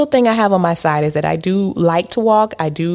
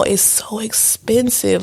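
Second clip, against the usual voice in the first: normal talking voice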